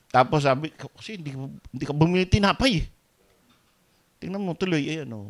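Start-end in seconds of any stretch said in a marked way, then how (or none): none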